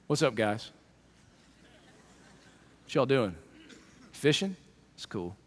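low, soft, easygoing greeting; low soft laid-back Jesus; casual Jesus. Acted. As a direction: stroll up calm and casual. Jesus walking on water voiced.

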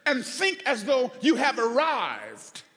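loud, driving sermon delivery; full-force preaching. Real, not acted. Preacher proclaiming grace to congregation, full-force preaching. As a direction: belt it out to the whole church.

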